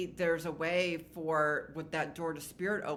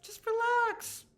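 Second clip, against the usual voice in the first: much higher, slow, soothing voice for the message; spirit's voice; 4 dB louder, slower than usual. Pretend. Reader voicing the spirit soothing her viewers, high and slow. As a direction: voice the spirit gently soothing them. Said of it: "I'm hearing".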